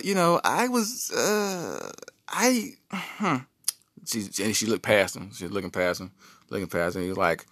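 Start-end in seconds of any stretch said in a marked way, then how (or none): 0.0-3.4 high, sassy wife voice. Retold or imitated. Wife mocking her husband's manhood, high and sassy. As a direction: hit back at him, high and sassy.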